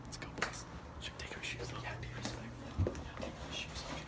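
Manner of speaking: sneaky hushed whisper. Real, not acted. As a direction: whisper so no one hears. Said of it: "We gotta be quiet".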